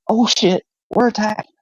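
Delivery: high-pitched pretend panic; mock-alarmed cat. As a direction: voice a cat suddenly under attack. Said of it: "the cat was like".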